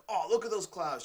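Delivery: delighted cloud gazer; wonder. Retold or imitated. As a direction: point at the sky in awe. Acting out noticing clouds.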